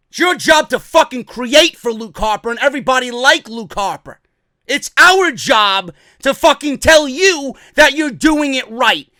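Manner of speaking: normal talking voice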